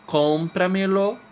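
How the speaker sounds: CÓMPRAMELO high, loud and slow; stress on first syllable. Shown as a demonstration. Teacher giving a sobresdrújula example, cómpramelo stressed on CÓM. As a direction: hit CÓM in cómpramelo, then stretch it out slowly. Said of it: "Con é a tônica".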